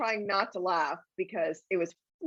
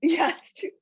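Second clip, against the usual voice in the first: laughing. Real, not acted. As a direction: still crying with laughter.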